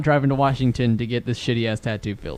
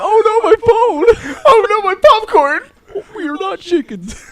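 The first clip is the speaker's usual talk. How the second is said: hammed-up panicked wail; 5 dB louder than usual. Friend teasing Jason about the dropped phone, hammed-up wail. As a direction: stretch it out in a silly high put-on voice.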